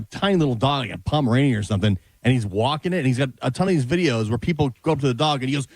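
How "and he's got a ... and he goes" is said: ordinary talk, nothing marked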